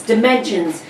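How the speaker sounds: ordinary talk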